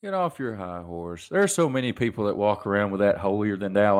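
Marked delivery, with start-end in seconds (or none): 0.0-1.3 quiet repeat. Real, not acted. Ranting about hypocrites, now softly to himself. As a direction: drop it to a near murmur.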